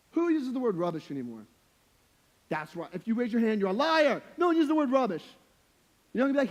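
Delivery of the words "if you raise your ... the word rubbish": loud, high comic outburst; mock-outraged preacher, building louder; mock accusation. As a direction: playfully call out the crowd, raising your voice.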